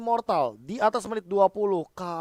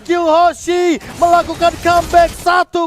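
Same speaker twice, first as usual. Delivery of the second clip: screaming esports climax; fighting game announcer; 7 semitones higher, 10 dB louder than usual. Real, not acted. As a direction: shout the comeback at full force. Caster screams comeback climax.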